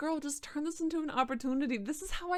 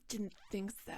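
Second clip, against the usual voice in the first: murmured much softer, self-satisfied; smug murmur; 2 semitones lower, 8 dB quieter than usual. A narrator's reading. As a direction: let it slip out softly, pleased with himself. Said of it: "he murmured smugly".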